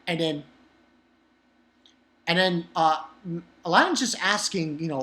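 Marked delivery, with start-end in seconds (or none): none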